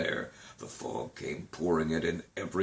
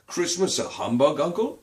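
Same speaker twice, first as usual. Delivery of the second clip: surprised question; 8 semitones higher, 3 dB louder than usual. A narrator's reading. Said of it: "said Scrooge's nephew".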